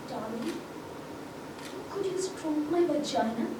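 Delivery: soft mock-seductive request; hushed, awkwardly proper bedroom voice; politically correct lover. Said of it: "if you use it during sex, trying to be politically correct".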